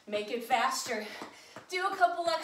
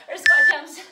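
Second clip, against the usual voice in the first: loud callout; 2 semitones higher, 15 dB louder than usual. Real, not acted. Sorting out the mix-up.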